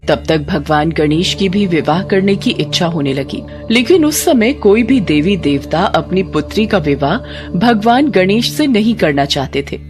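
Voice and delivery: ordinary delivery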